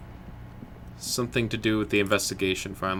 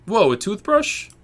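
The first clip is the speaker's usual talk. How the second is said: mock surprise; 12 semitones higher, 6 dB louder than usual. Real, not acted. Mock amazement at toothbrush.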